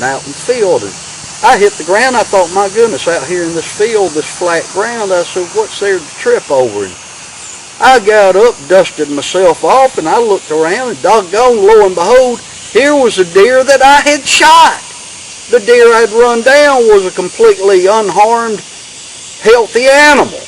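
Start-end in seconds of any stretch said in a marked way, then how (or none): 1.4-6.9 higher-pitched, puzzled retelling of a tumble; baffled storyteller. Retold or imitated. Climax, tripping in tall tale. 7.8-20.4 much higher, louder, building amazement; astonished tall-tale climax. Retold or imitated. Tall tale punchline, astonished discovery.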